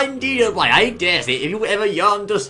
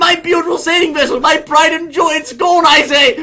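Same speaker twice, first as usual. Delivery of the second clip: higher, louder hammed-up lament; mock wail of grief; 7 semitones higher, 4 dB louder than usual. Host mourning his sunken vessel with a hammed-up wail. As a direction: wail for the lost ship like a ruined aristocrat.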